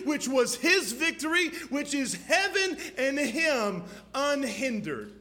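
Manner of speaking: loud, high, driving sermon climax; fiery preaching. Real, not acted. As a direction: preach it at full force, building. Preaching at full force.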